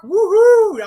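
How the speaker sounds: loud high woohoo; cheer. Real, not acted. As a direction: whoop it up for a friend. Cheering a viewer's arrival.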